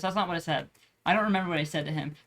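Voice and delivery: normal talking voice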